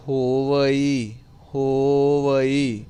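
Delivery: ਹੋਵਈ very slowly, ho-va-ee with long vowels; careful drawn-out syllables. Shown as a demonstration. Teacher asking for attention, drawn-out careful pronunciation. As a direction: stretch every syllable of ਹੋਵਈ. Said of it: "ਸੋ ਧਿਆਨ ਦੇਣਾ".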